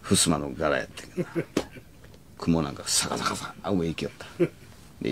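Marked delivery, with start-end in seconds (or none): none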